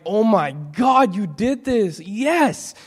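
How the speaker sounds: high, rising cry of joy; joyful thanks. Retold or imitated. Thanking God in the clinic, overjoyed and high. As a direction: praise it out, overjoyed. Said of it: "I was like".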